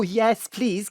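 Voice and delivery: normal talking voice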